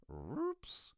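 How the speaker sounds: very high squeak; high squeaky badger. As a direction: squeak it cheerfully. Stuart voiced.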